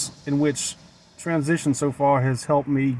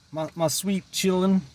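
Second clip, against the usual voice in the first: put-on a light Southern woman's accent, a bit higher; "chillin'" said the Southern way, dropping the d and the r; "chillin'" drawled in a hint of a Southern accent; 5 semitones higher than usual. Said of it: "maybe the real me is just a Southern woman".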